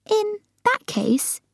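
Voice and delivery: very high, slow voice of Sophie; Sophie puzzled. Acted. Muddled Sophie reasoning with the giant, puzzled. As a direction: begin high and hesitantly.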